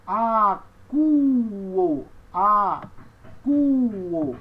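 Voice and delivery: "acuo" loud, in long syllables; slow, projected model for learners; pronunciation demo. Shown as a demonstration. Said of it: "Essa próxima palavra aqui".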